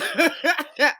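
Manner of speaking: high burst of laughter, falling away. Real, not acted. Storyteller laughing about the marriage misunderstanding, high laughter. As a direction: crack up at your own anecdote.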